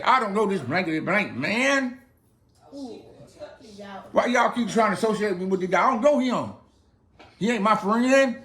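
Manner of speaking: mock anger, pushing them off; cursing denial. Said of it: "he began to curse and swear".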